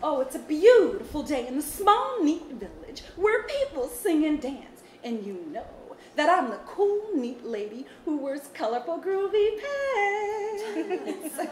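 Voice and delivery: high, slow storybook character voice; sing-song groovy auntie telling a story. Acted. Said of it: "In your Auntie B voice?".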